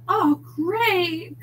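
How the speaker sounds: high, loud gushing delight; meltingly happy sweetheart; melty. A narrator's reading. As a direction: swoon with relief. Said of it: "Cookie's voice was all melty now".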